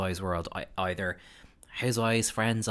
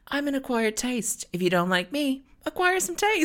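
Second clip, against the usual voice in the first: Ramona impression. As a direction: squeal it out like Ramona. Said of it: "Ramona going".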